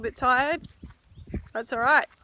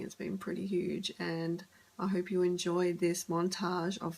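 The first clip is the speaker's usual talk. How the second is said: whispering; 4 semitones lower, 16 dB quieter than usual. Real, not acted. Others asleep, says goodbye softly.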